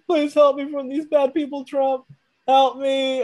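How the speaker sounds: high whiny mock sob of a pleading man; mock crying plea. Imitating the arrested man crying to Trump, high mock sob. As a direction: imitate him crying for help, hammed-up and high. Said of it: "he starts crying".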